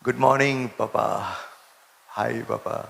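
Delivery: soft, tender hello to God; soft morning greeting. Shown as a demonstration. Believer greeting God softly each morning. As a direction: greet Papa gently on waking. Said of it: "સવારમાં ઉઠતાની સાથે".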